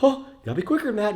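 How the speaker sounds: high, quick mocking old-timer voice; hammed-up old geezer, sped up; old guy. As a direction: poke fun at a grumpy old man scolding you. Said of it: "the old guy who's like".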